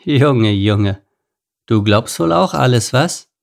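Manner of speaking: a touch of mocking smugness; condescending. A narrator's reading. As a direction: talk down to the little one. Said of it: "meinte überheblich".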